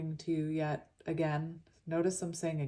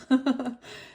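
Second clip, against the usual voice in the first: laugh; 7 semitones higher, 7 dB louder than usual. Real, not acted. Laugh at own lisp.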